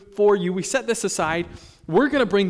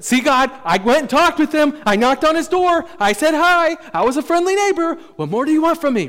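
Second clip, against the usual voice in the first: higher and louder, mock self-justifying to God; defensive checklist; 10 semitones higher, 8 dB louder than usual. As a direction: plead your case like you did enough. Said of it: "We kind of just have this checklist".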